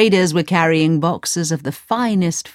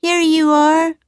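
much higher, bright maid; higher, sweet servant; servant handing over; 11 semitones higher, 4 dB louder, slower than usual. A narrator's reading. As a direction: hand it over cheerfully. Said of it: "she said".

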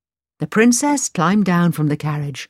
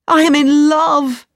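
high, slow and louder: I am in love; eager confession; 9 semitones higher, 6 dB louder than usual. Acted. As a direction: blurt out the confession with longing. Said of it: "Aladdin replied".

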